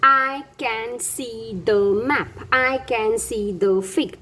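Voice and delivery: each word read very slowly and apart; slow model reading. Shown as a demonstration. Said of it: "ऐसे sentences देखिए".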